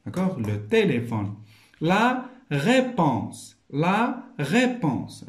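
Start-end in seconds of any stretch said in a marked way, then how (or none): 1.8-5.2 "réponse" split slowly as ré-ponse, raised; very slow teacher's model of "La réponse", pausing between syllables; syllable-by-syllable demo. Shown as a demonstration. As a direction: sound out "réponse" with a separate, clear "ré". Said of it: "غير من خلال الصوت".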